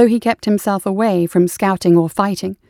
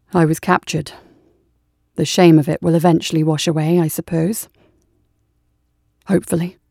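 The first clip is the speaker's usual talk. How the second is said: lower, ashamed soldier's voice; low weary soldier; 3 semitones lower than usual. Acted. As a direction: let the shame drag the voice down. Brahms speaks of his shame.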